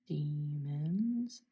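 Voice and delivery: normal talking voice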